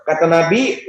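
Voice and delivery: usual speaking voice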